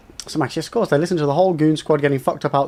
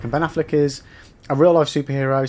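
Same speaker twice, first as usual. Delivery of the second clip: ordinary delivery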